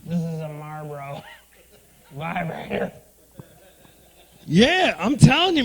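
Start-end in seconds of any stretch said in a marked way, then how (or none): 0.0-2.9 mom voice. Retold or imitated. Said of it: "She said".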